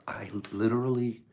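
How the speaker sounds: breathy hushed whisper. Real, not acted. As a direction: drop to a secretive whisper. Secretive aside.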